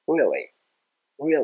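British "really" with relaxed R, lips spread; British English accent. Shown as a demonstration. Teacher contrasts accents for learners, relaxed British "really". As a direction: say "really" the British way, loose and wide-mouthed. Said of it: "イギリス英語だったら".

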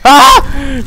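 sudden high scream of fright; startled yelp. Real, not acted. Player jump-scared by Slender, yelping. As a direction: yelp as the monster appears.

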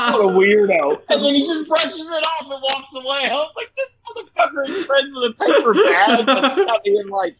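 high-pitched, laughing through the words; can barely get it out for laughing; telling a co-host about a scene while cracking up; laughing while talking. Real, not acted.

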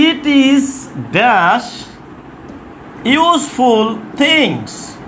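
very slow, word by word with pauses; slow dictation. Shown as a demonstration. Teacher dictating an exam question, very slow and spaced out. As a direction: draw out each word for writing down.

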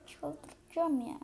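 slow exasperated moan, pitch up. Real, not acted. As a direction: let the frustration out slowly on çıldıracağım.

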